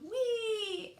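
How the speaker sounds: high, delighted whee; joyful yell. A narrator's reading. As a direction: cry out for the thrill of the ride. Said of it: "He yelled".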